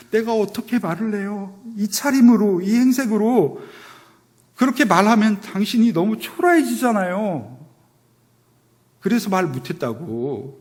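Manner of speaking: high, loud hurt protest; heroine pleading. Retold or imitated. Drama heroine answering the angry hero, high tearful protest. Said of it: "그러니까 여자 주인공이".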